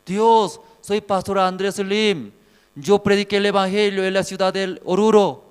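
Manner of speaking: high, loud self-presentation; boastful petitioner to God. Acted. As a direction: call your achievements up to God. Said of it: "Usted, cuando presenta ante Dios".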